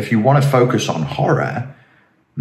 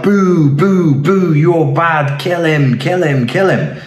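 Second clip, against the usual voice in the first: high, loud jeering boos, drawn out; mock crowd shouting; 8 semitones higher, 3 dB louder, slower than usual. Social media mob jeering from the sidelines, mock shouting. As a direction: heckle like a baying mob in the stands. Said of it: "and shout".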